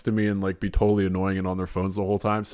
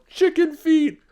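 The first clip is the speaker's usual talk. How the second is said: put-on high-pitched, raised child's shout; imitated little girl yelling; 5 dB louder, slower than usual. Imitating a little girl in the theater, shrieked heckle. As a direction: yell it like a little kid. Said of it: "some little girl in the movie theater yelled".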